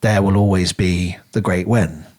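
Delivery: usual speaking voice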